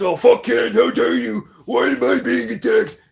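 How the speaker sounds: high, loud whiny mock shout; mocking how dare you, pitched high and loud; mock outrage. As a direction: mimic his self-pitying outrage. Mocking the victim act.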